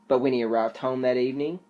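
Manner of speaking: usual speaking voice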